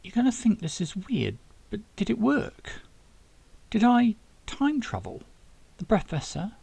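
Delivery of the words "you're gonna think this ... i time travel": higher, lifting question after question; young Zack, eager and unsure. Acted. Zack asking the scientists if time travel worked, rising higher. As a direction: ask it half afraid of the answer. Zack's character voice, rising disbelief.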